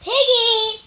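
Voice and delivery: loud high call to Piggie. Acted. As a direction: shout for your friend across the way. Elephant calls out to friend.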